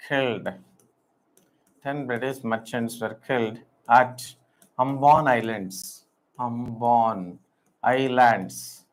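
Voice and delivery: halting dictation, phrases repeated after long pauses; slow dictation. Acted. Teacher dictating the Ambon story to students, slow and halting. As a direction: read it out piece by piece so students can write.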